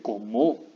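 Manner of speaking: cómo stressed on the last o, co-MÓ; cómo said as coh-MOH; wrong final stress. Shown as a demonstration. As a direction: push the stress to the end of cómo. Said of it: "No puede llevar el acento ahí".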